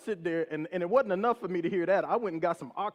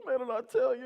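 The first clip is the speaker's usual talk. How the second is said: high, mock-emotional voice; imitated bawling cop; 6 semitones higher than usual. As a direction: gush it, pretending to be tearful. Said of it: "just bawling".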